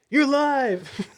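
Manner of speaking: squeaky high reveal; high teasing voice. Pretend. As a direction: sing out the gotcha up high. Acting out a prank call.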